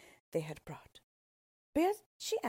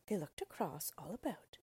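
ordinary delivery, nothing marked

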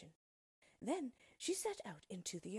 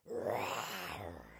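deep rasping animal roar; loud guttural lion growl; lion's roar; 13 semitones lower, 4 dB louder than usual. A narrator's reading. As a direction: roar low like a big lion. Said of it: "he roared".

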